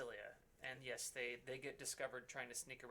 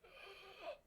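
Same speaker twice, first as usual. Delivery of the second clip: a quick high gasp of greed; sharp gasp; 6 dB quieter than usual. Retold or imitated. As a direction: gasp in sudden interest. Dragon heads react to offer.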